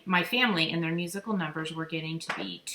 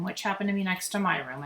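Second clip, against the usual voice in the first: ordinary delivery, nothing marked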